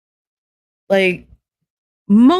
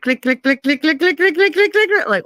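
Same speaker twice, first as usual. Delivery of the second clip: rising clicks, climbing higher with each one; meter clicking; 11 semitones higher than usual. Retold or imitated. To chat, mimicking the degeneracy meter, rising clicks. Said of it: "I can literally hear the meter going click".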